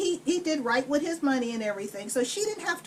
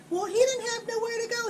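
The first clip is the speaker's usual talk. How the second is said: whiny excuse; 8 semitones higher, faster than usual. Retold or imitated. Connie's feeble excuse.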